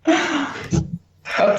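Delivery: thump of a hand hitting the mic; knocked microphone mid-word; mic bump. Real, not acted. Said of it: "sorry, I keep hitting my mic".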